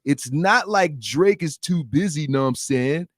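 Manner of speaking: slow nasal mocking interviewer voice; nasal voice with snot in the throat; DJ Vlad voice. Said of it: "I gotta have a nasally voice".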